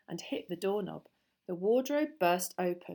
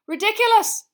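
very high, loud shout; full-voiced high yell; shouted spell; 14 dB louder than usual. A narrator's reading. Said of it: "shouted Seamus".

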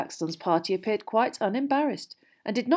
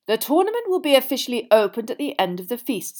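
loud, higher formal announcement; Dumbledore; 5 semitones higher, 6 dB louder than usual. A narrator's reading. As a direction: proclaim it to a great hall.

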